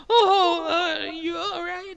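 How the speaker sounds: high-pitched, fretting woman's voice; acted high voice of concern; concerned lady. GM voices lady finding injured man.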